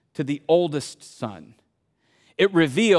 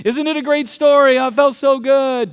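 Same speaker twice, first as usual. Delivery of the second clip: higher, louder gushing delight; happy moviegoer; 8 semitones higher, 7 dB louder than usual. Pretend. Audience leaving the movie happy, gushing loudly. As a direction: gush leaving the cinema. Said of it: "Everybody would leave happy".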